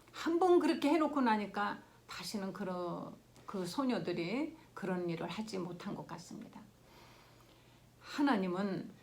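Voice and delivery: ordinary talk, nothing marked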